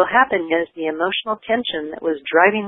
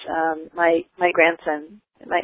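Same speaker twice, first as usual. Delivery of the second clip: ordinary talk, nothing marked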